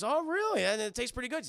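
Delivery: put-on high, loud, amazed kid voice; kid's delighted first taste; surprised kid. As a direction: gush like a kid who just tasted something great. Hogarth voiced tasting ice cream.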